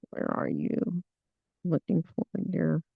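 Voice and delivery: soft, half-whispered aside while searching; muttered aside. Real, not acted. Host hunting for a guest on screen, muttered aside. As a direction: drop the voice, as if to yourself.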